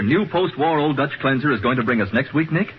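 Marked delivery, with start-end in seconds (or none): none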